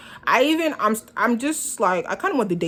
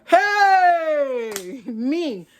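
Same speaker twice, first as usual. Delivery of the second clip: high, drawn-out "hey" of disbelief; mock outrage, sliding down from high; indignant exclamation; 6 semitones higher than usual. Storyteller reacting to her date's order, indignant.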